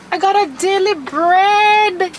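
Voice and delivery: high, drawn-out excited cry; thrilled, slow rising exclamation; delighted surprise. Real, not acted. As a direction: stretch the last word in delight. Delight at gift found.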